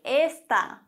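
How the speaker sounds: está, slow, stress on the final A, es-TÁ. Shown as a demonstration. Teacher showing es-TÁ to German learners, slow with final stress. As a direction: drag out está and land hard on the TÁ. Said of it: "Betonung auf dem A".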